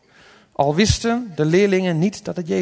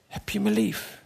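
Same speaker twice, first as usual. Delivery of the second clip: soft, higher, gentle asking; tender question; 4 semitones higher, 7 dB quieter than usual. Acted. Jesus asks Peter about his love, soft and tender. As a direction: ask it gently and softly.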